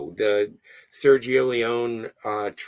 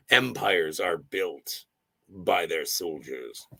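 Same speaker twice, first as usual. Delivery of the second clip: trailer tagline voice; 6 semitones lower, slower than usual. Shown as a demonstration. Reads tagline in trailer style.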